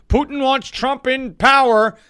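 mocking quote. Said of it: "push the narrative".

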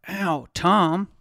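startled hurt outcry; cry of pain. A narrator's reading. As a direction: yelp out his name in sudden pain. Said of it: "She cried out".

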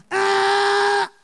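loud held buzzer blare; buzzer imitation. Preacher mimics an inner alarm at a handshake, loud buzzer blare. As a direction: blare it like a quiz-show buzzer.

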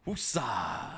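soft, slow relaxing breath-word; calming woosah. Shown as a demonstration. Cohost calms himself down before the retake, soft and slow. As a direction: let it out like a calming mantra. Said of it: "have to relax".

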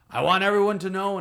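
put-on proud Spanish-accented priest addressing everyone; thick Spanish accent. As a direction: announce it to the room in a heavy Spanish accent. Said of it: "in his thick Spanish accent".

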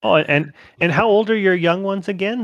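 put-on Midwestern accent. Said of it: "all of my Midwestern accents sound the same".

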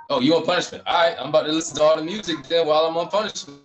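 playing his younger self, thrilled at the free time; excited kid quote; gleeful self quote. Mock self quote getting punished.

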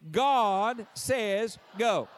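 drawn-out, emphatic preacher's proclamation; forceful Pentecostal delivery, each word stretched. Shown as a demonstration. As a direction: lead the crowd in a slow preaching chant. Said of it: "Look back at me and say it again".

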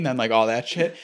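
ordinary talk, nothing marked

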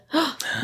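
short, soft mock gasp; dramatic gasp. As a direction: gasp as if at a plot twist. Mock suspense before a plot reveal.

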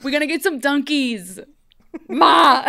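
hammed-up Bostonian ordering Dunkies; Dark One Emma as a Boston local; Boston accent. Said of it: "she goes to Dunkin's".

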